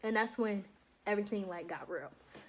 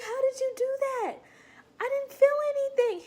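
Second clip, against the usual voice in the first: much higher, louder astonished; amazed excitement; 5 dB louder than usual. Retold or imitated. Recruit questioning the doctor after the needle, high amazed gush. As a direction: gush with disbelief. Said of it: "I was like".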